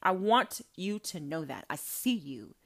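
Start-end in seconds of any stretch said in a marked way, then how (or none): none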